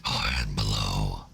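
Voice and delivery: very low, slow demon voice; demonic voice. Pretend. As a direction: drag it out like a demon. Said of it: "in demonic voice for?".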